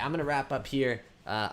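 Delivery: ordinary talk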